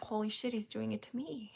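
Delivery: soft retold disbelief; quiet mock awe; hushed inner thought. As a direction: murmur it to yourself, amused. Retold stunned private thought.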